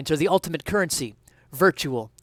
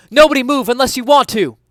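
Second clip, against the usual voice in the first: barking cop order; 10 semitones higher, 11 dB louder than usual. Acted. Detective commands the room.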